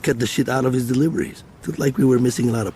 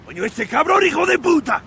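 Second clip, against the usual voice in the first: cursing loudly, high and enraged; furious outburst; 5 dB louder than usual. Acted. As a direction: explode at the news over the phone. On phone, hears bad news.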